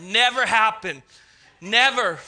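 NEVER punched out loudly; loud, absolute refusal on each never; emphatic denial. Real, not acted. Preacher joking to his congregation about his notes, emphatic denial.

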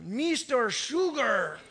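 slow, stretched imitated accent on MISTER SUGAR; his accent. Tipsy regular's nickname for the pastor, drawled accent. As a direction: draw out both words in the man's accent. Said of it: "Not Mister Sugar".

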